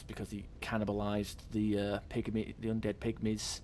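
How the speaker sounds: ordinary delivery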